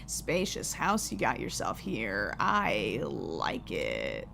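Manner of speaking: low, quiet, threatening visitor voice; menacing deep stranger. Acted. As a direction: drop deep and slow like a creeping intruder. Reads menacing stranger's lines.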